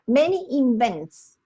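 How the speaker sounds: "many invents" slow and loud, each word set out; flagging the error. Shown as a demonstration. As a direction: hold up "many invents" slowly for correction. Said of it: "What's the problem with this".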